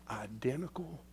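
whispered identical. Acted. Preacher whispering a key word to the church, hushed. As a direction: breathe identical out softly.

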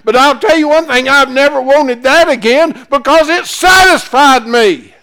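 high, loud preacher's holler, full of joy; shouted preaching. Acted. Preacher telling the church his answer to God, hollering. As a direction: ring out the testimony to the back pews.

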